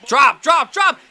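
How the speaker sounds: high, loud shouted urging; will the ball to fall; urgent shouting. Real, not acted.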